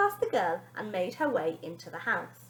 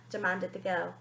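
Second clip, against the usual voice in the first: ordinary delivery, nothing marked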